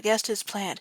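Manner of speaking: ordinary delivery, nothing marked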